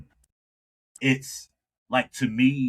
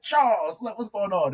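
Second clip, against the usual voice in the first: mock happy greeting; 12 semitones higher than usual. Chuck recognizing Charles years later, high happy greeting. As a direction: greet a long-lost buddy with joy.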